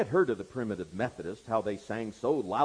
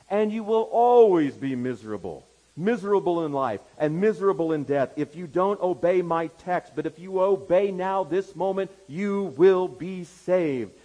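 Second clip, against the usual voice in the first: loud, raised preacher's pronouncement; pulpit warning; 6 semitones higher, 8 dB louder than usual. Acted. Preacher singling out a young stranger, forceful warning.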